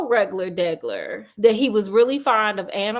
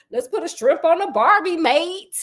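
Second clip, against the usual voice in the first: higher-pitched hammed-up Aussie accent; put-on Aussie twang, pitched up; mock British-Australian accent; 6 semitones higher than usual. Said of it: "he would do the accent".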